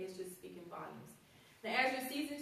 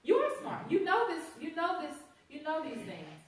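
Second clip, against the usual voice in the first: high, louder, building praise; emphatic praise; 7 semitones higher, 6 dB louder than usual. Real, not acted. Speaker praising teachers as experts, high and emphatic. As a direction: lift them up with conviction.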